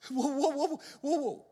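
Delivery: high, halting stammer on whoa; stuttering Moses. Acted. Moses stammering to God, high and halting. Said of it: "he stutters by now".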